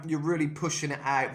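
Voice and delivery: usual speaking voice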